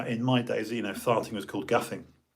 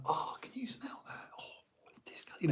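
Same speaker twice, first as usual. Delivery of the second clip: hushed, higher mock disgust; 6 semitones higher, 12 dB quieter than usual. Schoolboy in class blaming the smell, hushed mock disgust. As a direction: fake outrage under your breath. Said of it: "So I'm like".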